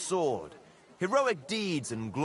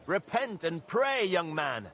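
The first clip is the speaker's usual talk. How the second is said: fervent preaching; 6 semitones higher, 3 dB louder than usual. Acted. Preacher exhorts, rising.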